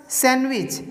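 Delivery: सैंडविच as "san-wich", no d; silent d. Shown as a demonstration. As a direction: model सैंडविच with the d left out. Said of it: "सैंडविच नहीं सैंडविच". (सैंडविच, ड के बिना "सैनविच")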